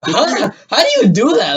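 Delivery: high-pitched retold disbelief; incredulous. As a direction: pitch it up in baffled amazement. Retelling disbelief at classroom story.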